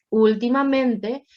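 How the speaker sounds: "Últimamente" slowly, loading "Úl" and again "men"; double stress. Shown as a demonstration. Teacher repeats the example for students, stressing "Úl" and "men" in "Últimamente". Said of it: "carga de voz en la pri".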